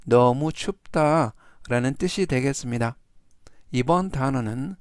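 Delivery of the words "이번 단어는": ordinary delivery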